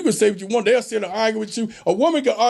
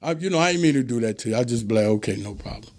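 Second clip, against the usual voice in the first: cool and calm; 9 semitones lower, faster than usual. Retold or imitated. Man calmly soothing his upset woman, unbothered. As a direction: let it roll off you, easy and low. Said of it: "I'm saying".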